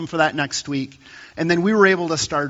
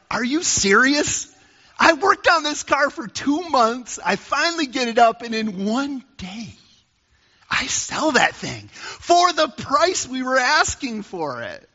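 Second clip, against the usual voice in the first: much higher, indignant outburst, slowing on the key words; angry retort; 8 semitones higher than usual. Retold or imitated. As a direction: replay the angry snap at your wife. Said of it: "I got immediately angry".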